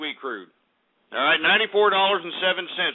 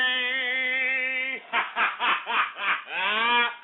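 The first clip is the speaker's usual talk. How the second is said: gleeful cackling laugh; laughter; 6 semitones higher, 3 dB quieter than usual. Real, not acted. Host laughing after gloating about snow. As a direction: laugh at your own joke.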